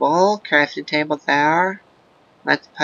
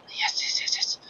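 soft whispered yeses; 10 dB quieter than usual. Real, not acted. Gamer whispering yes over found coal. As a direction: whisper with glee.

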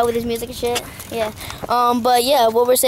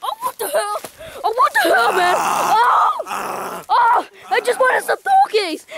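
much higher, hammed-up wailing panic; panicked screaming; 11 semitones higher, slower than usual. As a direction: cry out as the animal attacks you. Acted bear attack on the host.

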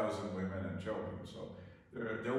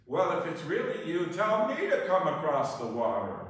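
a hint of Peter calling out, higher and louder; lightly voiced Peter; 6 semitones higher, 5 dB louder than usual. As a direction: lightly act the disciple calling across water. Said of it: "And then Peter says".

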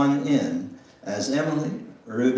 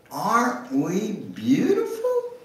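delighted; 10 semitones higher than usual. Acted. Emily delighted at the mirror.

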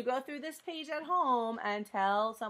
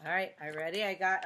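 normal talking voice

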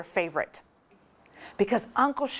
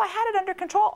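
higher, pleading insistence; Sean's defensive quote; 11 semitones higher, faster than usual. Retold or imitated. Brother reassuring his sister, high and pleading. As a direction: insist you had it handled. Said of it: "He goes".

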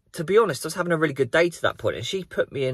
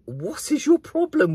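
much higher, slow mock outrage; exasperated. Vlogger retells his exasperation at the rude woman driver. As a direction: stretch it out in disbelief. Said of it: "it was just like".

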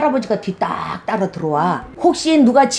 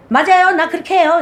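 higher, louder client's eager reply; client answering; 6 semitones higher, 3 dB louder than usual. Retold or imitated. Client cheerfully admits to the shaman she curses, high eager reply. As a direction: chirp it back as the client.

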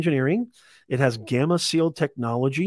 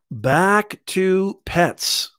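very slow, drawn-out echo of the line; slow repeat; 5 semitones higher, 3 dB louder, much slower than usual. Real, not acted. Host repeating to viewers, slow and drawn out. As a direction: stretch each word like a sing-song refrain. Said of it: "Okay, back to pets".

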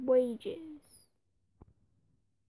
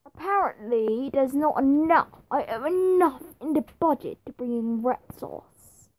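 louder, indignant complaint; loud rant; 9 dB louder than usual. Real, not acted. Gamer ranting about the budget, loud and indignant. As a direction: vent it loudly at the screen.

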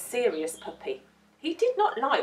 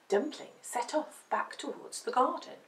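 ordinary delivery, nothing marked

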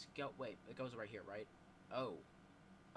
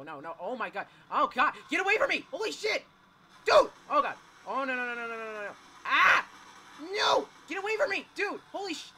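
terrified horror victim; 8 semitones higher, 15 dB louder than usual. Real, not acted. Monster catches up, screaming.

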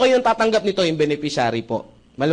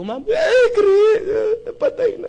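high, wailing cry as the grieving widow; mock wailing widow; much slower than usual. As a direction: sob it out high and shrill like a widow in mourning. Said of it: "Iyak eh".

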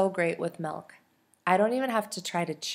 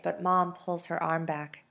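plain reading voice

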